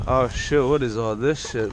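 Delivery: normal talking voice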